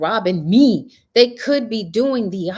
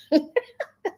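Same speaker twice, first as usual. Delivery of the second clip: high laugh; 10 semitones higher than usual. Real, not acted. Laughing at grandson's story, high laugh.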